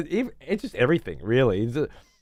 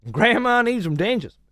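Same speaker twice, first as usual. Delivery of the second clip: high, loud mock old-lady plea; mock granny gambler; 11 semitones higher, 4 dB louder than usual. Mocking a grandma at the pokies, high and loud. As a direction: play up a pokies-hooked grandma.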